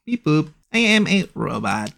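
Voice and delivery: stiff robot voice. Pretend. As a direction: speak like a clunky robot. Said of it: "do a robotic voice like this".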